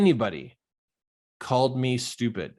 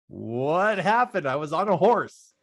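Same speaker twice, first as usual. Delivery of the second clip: bewildered retold reaction; 6 semitones higher, 3 dB louder than usual. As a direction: act out waking up confused, pitch raised. Retelling his dazed confusion after waking.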